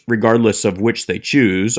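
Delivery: usual speaking voice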